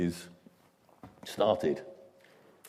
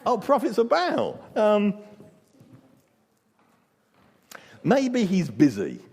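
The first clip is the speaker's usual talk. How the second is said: higher, sarcastic friendly tone; mock encouragement; 10 semitones higher than usual. Elijah mocking the prophets of Baal with fake friendliness. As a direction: tease them sweetly. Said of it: "friendly encouragement".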